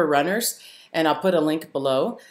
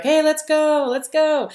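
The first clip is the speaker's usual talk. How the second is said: high, eager call to come rafting; eager friend; 11 semitones higher than usual. Retold or imitated. Friend calling him out to go rafting, eager. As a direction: chirp it like a keen buddy. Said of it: "he'd be like".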